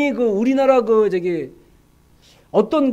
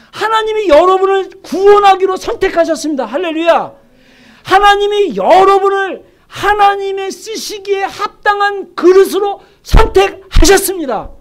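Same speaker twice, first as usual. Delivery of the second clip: high, loud pulpit proclamation; preaching at full force; 8 semitones higher, 7 dB louder than usual. Real, not acted. Preacher telling the congregation they are chosen, full force. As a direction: declare it to the whole congregation, raised voice.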